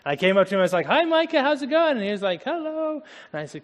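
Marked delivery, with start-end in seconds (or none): 0.9-2.0 bright, much higher friendly hello; cheerful high greeting. Retold or imitated. 2.4-3.0 put-on a much higher, small child's hello; shy little boy. Boy answers, voiced by speaker.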